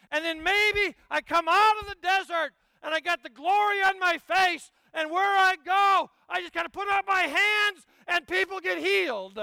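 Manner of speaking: building to a high, loud hammed-up shout; mock grand glory. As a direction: ham up the glory-filled mystic, rising to a cry. Mock fantasy of glory-filled mystic.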